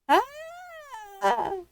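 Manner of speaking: high startled shriek; frightened cry. A narrator's reading. Granny shrieks as the wolf leaps at her. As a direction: yelp in fright. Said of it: "cried Granny".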